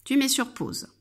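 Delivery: usual speaking voice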